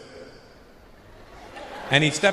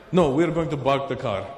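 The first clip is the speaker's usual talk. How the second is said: put-on Lebanese accent. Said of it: "there's a big Lebanese guy".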